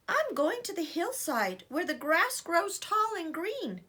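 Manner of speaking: high, eager little goat; bright high kid goat voice. Acted.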